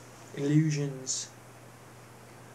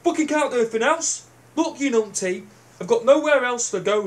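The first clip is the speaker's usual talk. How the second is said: raised, rising angry voice; heated retort; 6 semitones higher, 5 dB louder than usual. Retold or imitated. Vlogger acts out yelling back at a numpty, angry and raised. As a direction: snap back at them, getting louder.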